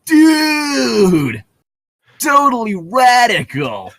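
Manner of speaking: high, loud, slow surfer-dude shout; nineties surfer voice. Pretend. Asked for a nineties voice, shouting like a surfer dude. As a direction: hype it up like a rad nineties surfer. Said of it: "do your best nineties voice".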